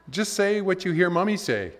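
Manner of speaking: mother. Retold or imitated. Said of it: "the mother answered".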